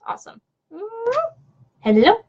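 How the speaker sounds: ordinary delivery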